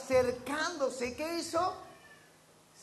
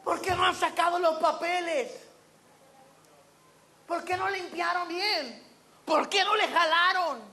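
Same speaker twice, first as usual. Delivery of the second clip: mock indignant complaints; 5 semitones higher, 4 dB louder than usual. Mocking bathroom complaints.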